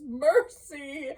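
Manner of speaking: high, loud cry for mercy; terrified plea. Acted. Scrooge on his knees before the ghost, high terrified plea. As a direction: beg on your knees, voice cracking up. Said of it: "fell upon his knees".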